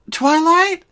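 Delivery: high, loud call into the empty room. A narrator's reading. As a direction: call for her friend across the library. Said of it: "she called out".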